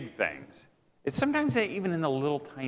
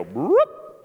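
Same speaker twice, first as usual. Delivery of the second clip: quick rising "whoop" sound effect; vocal whoosh sliding sharply upward; imitated swerve noise; 4 dB louder than usual. Preacher showing the dogs veering off, cartoon noise.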